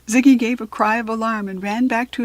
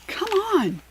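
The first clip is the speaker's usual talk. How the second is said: urgent call; 11 semitones higher than usual. A narrator's reading. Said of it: "said Kirsty".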